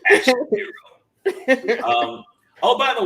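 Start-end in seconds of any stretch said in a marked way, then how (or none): 0.1-0.7 high, loud trailer voice; action hero voice. Pretend. Echoing the action hero idea in a loud trailer voice. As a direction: announce it like a blockbuster. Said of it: "sounds like an action hero".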